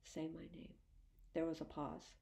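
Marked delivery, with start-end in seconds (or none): 0.0-0.7 soft hushed plea. A narrator's reading. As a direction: say it hushed like a prayer. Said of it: "I said 'em hushed like a prayer".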